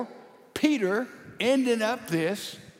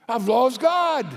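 alarmed realisation; 5 semitones higher, 9 dB louder than usual. Acted. Panicking apostle cannot get through.

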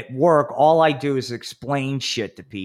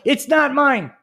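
high, loud panicked denial; flustered denial; 13 semitones higher, 5 dB louder, slower than usual. Retold or imitated. Businessman at airport security denying the vibrator, panicked. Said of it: "the guy was like".

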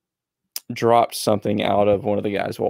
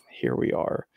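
hushed aside; 7 dB quieter than usual. Retold or imitated. Inner thought, resigned.

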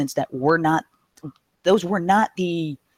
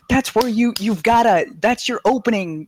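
urgent raised voice; 7 semitones higher, 3 dB louder than usual. Retold or imitated. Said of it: "I'm like".

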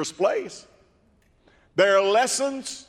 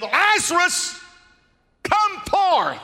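high, louder ringing call to Lazarus; commanding call; 11 semitones higher, 4 dB louder, slower than usual. Acted. Preacher voicing Jesus calling Lazarus from the tomb, booming. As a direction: call him out of the tomb with power.

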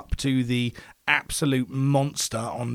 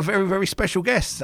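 special leaned on, higher; pitched-up very, very special; emphasis on special; 8 semitones higher than usual. Shown as a demonstration. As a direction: hit the word special. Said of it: "I put the emphasis on the word special".

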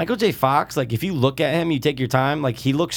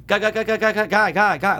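high, repeated stuttering syllables; stuttery imitation; 7 semitones higher than usual. Friend asks about the screens, imitating their stuttery sound. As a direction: imitate a glitchy stutter, pitched up. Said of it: "like, stuttery".

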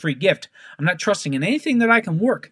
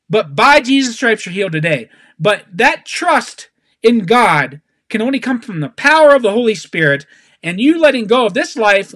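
raised, insistent preacher's delivery; punchy, emphatic exhortation; fervent preaching; 6 semitones higher, 6 dB louder than usual. Real, not acted. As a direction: drive each phrase home to the congregation. Preaches with full force.

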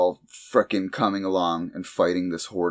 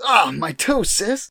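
higher, louder yelp of pain; mock pained yelp; 12 semitones higher, 5 dB louder, slower than usual. Cell stubbing toe on a couch, higher mock yelp. Acted quote of character.

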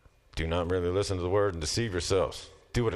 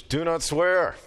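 emphatic command; 9 semitones higher, 6 dB louder, much slower than usual. Acted.

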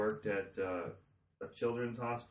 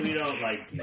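laughing quote; 4 semitones higher, 5 dB louder than usual. Retold or imitated. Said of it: "they said".

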